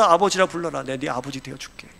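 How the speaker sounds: low, hushed reassurance; God reassuring believers. Acted. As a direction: speak gently down to a child, promising acceptance. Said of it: "그 하나님의 우리를 향하여서".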